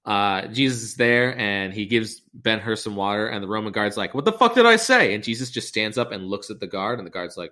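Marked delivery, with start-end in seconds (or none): 4.1-5.1 high, loud outraged yell; furious yell. Retold or imitated. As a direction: roar at the disobedient prisoner. Guard yelling at Ben-Hur.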